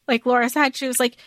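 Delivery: ordinary talk, nothing marked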